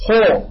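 خو long, dark and rounded; full "khaw" on خو; correct heavy kha. Shown as a demonstration. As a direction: model the heavy خو. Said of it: "هكذا".

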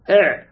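"إع" with the ع voiced from the throat; teacher isolating ع in "إع"; Ayn demo. Shown as a demonstration. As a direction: let the ع of "إع" flow, not snap shut. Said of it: "لاحظت مع العين".

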